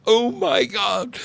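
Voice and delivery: high, drawn-out shocked exclamation; slow, high-pitched disbelief; mock horror. A narrator's reading. Acted shock at the reveal.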